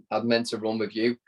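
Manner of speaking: ordinary talk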